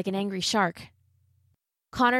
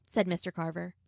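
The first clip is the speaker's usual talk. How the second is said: usual speaking voice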